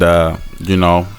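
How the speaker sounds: ordinary talk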